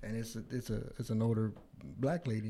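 ordinary talk

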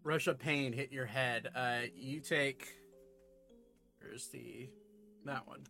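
usual speaking voice

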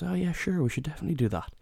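jumps way up high on oh yeah, then eases down; mock eager friend. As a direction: play the friend keen to go, voice suddenly lifted. Friend's eager yes, retold.

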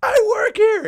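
very high, loud yelp; panicked mock shout. Scared server to the brawler, panicked mock shout. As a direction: re-enact a desperate yell.

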